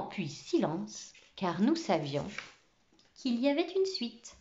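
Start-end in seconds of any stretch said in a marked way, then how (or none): none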